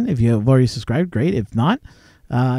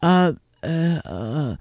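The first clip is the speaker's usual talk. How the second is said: halting mock hesitation, trailing off softer; imitated flummoxed stammer; 4 dB quieter, much slower than usual. Telemarketer baffled by a prank caller, flummoxed stammer. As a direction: play someone lost for an answer. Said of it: "did not know how to answer my questions".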